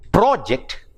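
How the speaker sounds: PRO-ject, falling from a strong first syllable; first-syllable stress on project. Shown as a demonstration. Teacher giving an example of the noun, stressed PRO-ject. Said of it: "stress on the first syllable".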